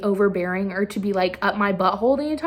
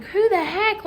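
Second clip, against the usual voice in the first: high, incredulous who-the-heck; mock disbelief at a twist; shocked question; 10 semitones higher than usual. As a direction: jump way up in pitch in disbelief. Reacting to diary reveal.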